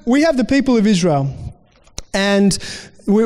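normal talking voice

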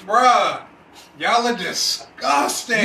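slow yell into mic, distorted; shout, mic clipping. Real, not acted. Yelling back about farting.

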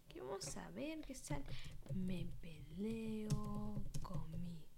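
slow unvoiced whisper; suspense whisper. Real, not acted. Waiting for search suggestions, slow suspense whisper. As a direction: draw it out in a hush.

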